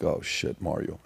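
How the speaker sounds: whispered alarm to a friend. Retold or imitated. Warning his friend as a stranger runs up, whispered. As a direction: hiss it under your breath, alarmed. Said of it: "I'm like".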